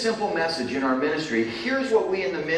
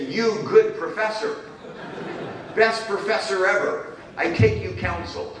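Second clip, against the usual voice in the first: imitating the minister; 7 semitones higher than usual. To the professor, a minister gravely accepting counsel, in a higher voice. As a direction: play the earnest Sudanese minister, pitched up. Minister replies in broken English.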